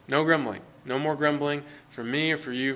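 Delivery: normal talking voice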